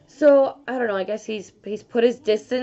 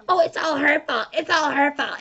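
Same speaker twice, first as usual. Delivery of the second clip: mock blaming; 4 semitones higher, 3 dB louder than usual. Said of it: "I'm not saying".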